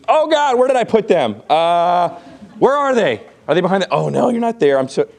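high, flustered mock search; hammed-up God fumbling for them; mock confused God. Teacher mocking a confused God to class. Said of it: "God got confused".

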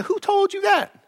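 high, sharp disbelieving question; incredulous retort. Retold or imitated. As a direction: shoot back in disbelief. Pastor retorting to couples.

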